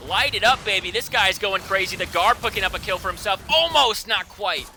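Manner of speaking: fast, loud fight call; hype play-by-play. Real, not acted. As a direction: push the energy through every kill. Hype casting of a teamfight.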